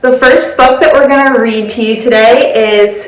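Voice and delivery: put-on loud, bright storytime voice for kids; animated children's storytime reading; lively read-aloud. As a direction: read it out big and bouncy for children. Animated picture-book read-aloud.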